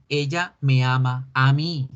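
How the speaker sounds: ordinary delivery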